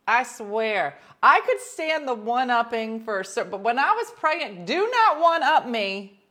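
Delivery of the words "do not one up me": slow loud warning. Retold or imitated. To husband who one-ups her pregnancy, loud slow warning. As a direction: do not one-up me, slow and loud, high and forceful.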